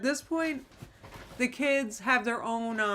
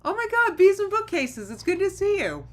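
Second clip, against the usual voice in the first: excited greeting; 11 semitones higher, 8 dB louder than usual. Real, not acted. Greeting a viewer who just joined.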